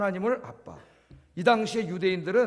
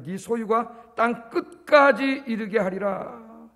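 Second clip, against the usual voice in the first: drawn-out scripture proclamation; slow solemn verse reading; 2 semitones higher, 3 dB louder, much slower than usual. Acted. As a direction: read it very slowly and solemnly.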